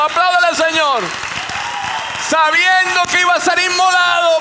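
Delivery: alto y fuerte, gritando con júbilo; predicación a todo pulmón. Real, not acted. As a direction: proclama la libertad con fervor creciente. Preaching at full force. (predicador gritando con euforia)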